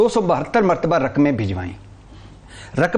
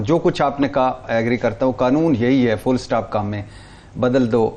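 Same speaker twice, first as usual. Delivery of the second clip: ordinary delivery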